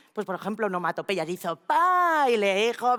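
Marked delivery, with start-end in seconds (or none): 1.6-2.3 a loud, high, playful "Pa" smack sound; mock smack noise. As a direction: blurt it like slapping the table. Onomatopoeia at the barbecue.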